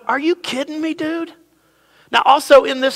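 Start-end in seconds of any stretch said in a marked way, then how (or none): 0.1-1.3 incredulous. Acted. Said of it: "the neighbor's going".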